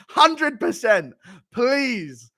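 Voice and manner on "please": ordinary talk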